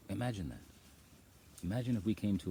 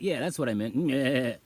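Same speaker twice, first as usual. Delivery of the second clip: louder, higher, delighted; excited reply; 7 semitones higher, 9 dB louder than usual. Retold or imitated. Answering the goat excitedly, then bleating. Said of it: "I was like".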